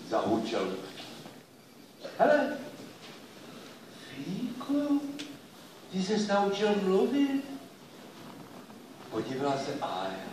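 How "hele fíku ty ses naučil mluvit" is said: put-on high, surprised child voice; amazed child. Girl Ája, amazed the dog can talk, high voice. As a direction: gasp it out, astonished.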